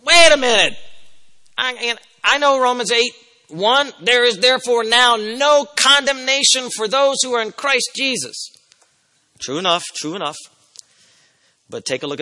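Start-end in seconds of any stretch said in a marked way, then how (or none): 0.0-8.5 higher-pitched protest from the pews; acted-out objection, quoting scripture in a raised voice; objecting listener. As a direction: break in insistently, pitched well above your own voice.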